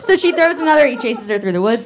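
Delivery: normal talking voice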